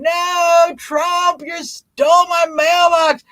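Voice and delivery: high, loud, slow hammed-up wail; mock scream. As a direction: scream it at the sky like a cartoon victim. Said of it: "I'm screaming".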